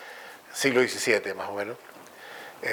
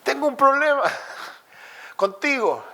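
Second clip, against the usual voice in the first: much higher, cartoonish complaint with a laugh; high mock voice; 14 semitones higher than usual. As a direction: whine your problem comically. Descartes complains to Aristotle.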